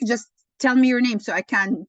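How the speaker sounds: ordinary talk, nothing marked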